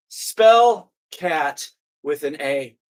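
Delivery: very slow, deliberate words; slow patronizing spelling. Acted. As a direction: spell it out like to a child. Worker speaking slowly to her.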